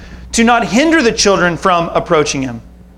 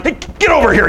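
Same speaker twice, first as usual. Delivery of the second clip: mock parental order, pitched up; quick, higher-pitched pretend telling-off; imitated parent scolding; 5 semitones higher, faster than usual. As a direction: lightly act a harried parent calling kids over. Voices parent calling unruly children.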